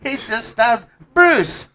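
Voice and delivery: shrill mock-cheerful Canadian bystander; South Park Canadian. Canadian bystanders shrugging off a stabbing in South Park accent. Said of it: "you sound like a guy from South Park".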